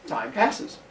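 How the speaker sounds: normal talking voice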